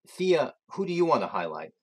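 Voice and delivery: usual speaking voice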